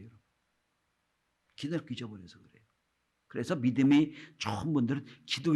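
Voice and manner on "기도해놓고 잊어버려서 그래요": 기도해놓고 repeated much softer and quick. Real, not acted. Pastor repeats the point to let it sink in, softly. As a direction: drop the voice on the repeated 기도해놓고 잊어버려서.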